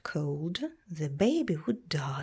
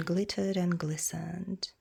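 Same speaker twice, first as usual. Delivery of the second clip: ordinary delivery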